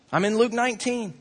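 ordinary delivery

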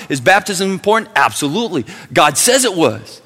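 loud, high call-and-answer; full-force preaching. Acted. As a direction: fire questions, answer with a hard no.

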